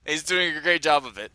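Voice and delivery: usual speaking voice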